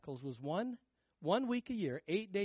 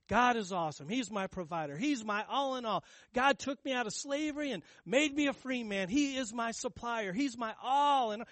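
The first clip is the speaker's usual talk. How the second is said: rising, louder praise shouts, higher and higher; joyful declaration; 6 semitones higher, 5 dB louder than usual. Pretend. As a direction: proclaim it like a worshipper lost in praise. Pretend worshippers declaring God's glory.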